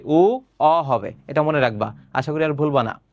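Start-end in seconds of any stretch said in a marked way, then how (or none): 0.0-0.8 উ, অ drawn out very slowly, sound by sound; correct pronunciation. Shown as a demonstration. Teacher repeating the right reading slowly for the class. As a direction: stretch উ, অ slowly so they hear both vowels. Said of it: "উও হবে".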